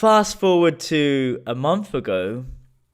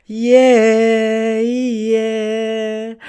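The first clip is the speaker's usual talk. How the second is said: excited cheer; 9 semitones higher, 8 dB louder than usual. Real, not acted. Hyped after shouted affirmations.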